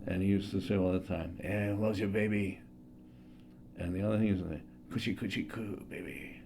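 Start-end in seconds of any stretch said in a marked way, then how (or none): 1.4-6.4 Kojak impression. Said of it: "the last one I wanted to do was Kojak".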